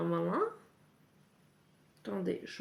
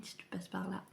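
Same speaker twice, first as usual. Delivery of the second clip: whisper; 8 dB quieter than usual. Real, not acted. Aside to friend Emeline.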